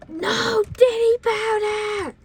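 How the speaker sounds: high, drawn-out holler; yelled. Retold or imitated. Bus driver banning the powder, long high yell. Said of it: "she yelled".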